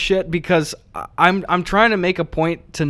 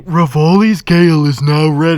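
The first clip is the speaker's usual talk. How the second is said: loud put-on game character voice; mock booming announcement; 3 semitones higher, 7 dB louder than usual. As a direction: blare it out like an intrusive game alert. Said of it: "louder than everything".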